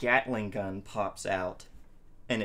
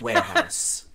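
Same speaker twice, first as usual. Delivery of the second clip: 1 excited shout; 9 dB louder than usual. Acted. Player shouts answer excitedly.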